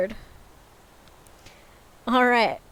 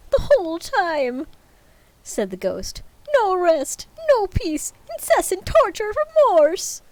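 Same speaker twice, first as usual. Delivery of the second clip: high and louder, building lament; anguished ghost; 11 semitones higher, 6 dB louder, slower than usual. Acted. Ghost wails its torment to Scrooge, high and loud. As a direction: wail out the torment.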